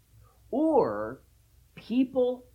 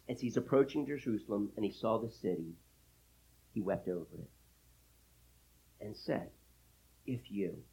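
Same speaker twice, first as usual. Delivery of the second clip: hushed, low reading with pauses; grieved; 8 semitones lower, 8 dB quieter than usual. Real, not acted. Preacher reading of Jesus weeping over Jerusalem, grieved. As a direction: sink into sorrow, softly. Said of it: "I just feel the emotion".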